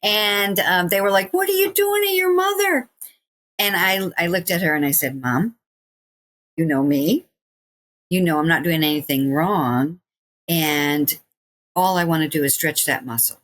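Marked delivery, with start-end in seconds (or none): 1.3-2.9 high-pitched shocked challenge; imitated alarm. Acts out alarmed friends' challenge. 5.2-13.4 lower, measured coaxing with pauses; steady a frightened mother, low and unhurried; retold reassurance. Acts herself reassuring her crying mother.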